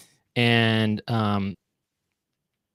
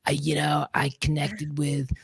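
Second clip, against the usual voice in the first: Whoopi Goldberg impression; 3 semitones higher than usual. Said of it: "She actually sounds like this".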